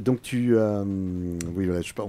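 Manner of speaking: ordinary talk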